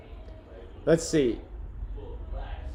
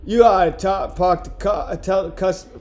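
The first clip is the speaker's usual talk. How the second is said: put-on higher, halting stabs at a Boston accent; Boston accent attempt; 5 semitones higher, 5 dB louder than usual. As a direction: fumble through a Boston accent, restarting. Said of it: "trying to do a Boston accent".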